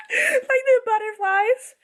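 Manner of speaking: very high, overcome squeal; giddy squeal. Real, not acted. Reader swooning at the flirty scene, giddy squeal. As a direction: squeal it as if overwhelmed with butterflies. Giddy over butterflies in stomach.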